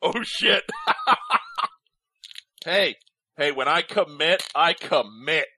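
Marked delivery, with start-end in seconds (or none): 0.7-1.7 squeaky laugh. Real, not acted. Laugh after singing.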